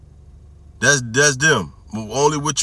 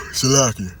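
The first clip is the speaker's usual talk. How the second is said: yawning. Real, not acted. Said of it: "here come that yawning demon".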